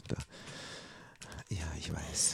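breathy whispered reply; whispering. Real, not acted. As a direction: whisper it under your breath. Answers teammate quietly mid-fight.

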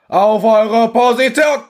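loud, high, slow barked order; sergeant's command. Acted. Sergeant ordering the troops to their posts, loud and high. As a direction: bellow the order across the trench. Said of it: "Befiehlt der Sergeant".

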